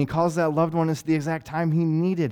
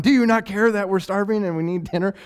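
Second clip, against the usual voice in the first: mock panicked husband; 8 semitones higher, 5 dB louder than usual. Mock husband waking wife.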